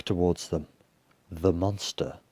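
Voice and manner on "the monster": ordinary delivery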